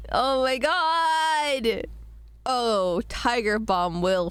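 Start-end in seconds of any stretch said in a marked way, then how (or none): 0.1-1.9 slow, high, drawn-out wail; exasperated. Real, not acted. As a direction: draw it out in disbelief. Mortified she was muted.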